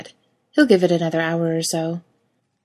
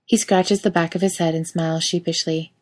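ordinary delivery, nothing marked

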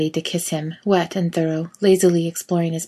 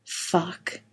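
low fervent moan; 6 dB quieter than usual. A narrator's reading. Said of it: "a long, low, fervent".

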